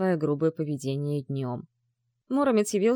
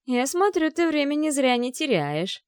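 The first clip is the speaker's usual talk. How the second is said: higher, louder taunting jab; mocking; 7 semitones higher, 3 dB louder than usual. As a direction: tease her with scorn. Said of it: "насмешливо сказала та".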